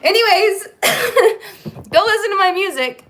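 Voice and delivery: high, loud and giggly; real laughter between the phrases; laughing plug. Real, not acted. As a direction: blurt a plug through a laughing fit. Laughing after collapsing from handstand.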